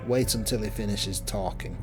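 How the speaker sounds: ordinary talk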